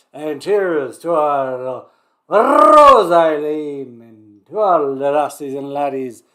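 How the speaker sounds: slow, loud Scottish toast; Scottish accent. Acted. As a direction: raise a glass in a hammed-up Scottish accent. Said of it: "let's do a Scottish accent".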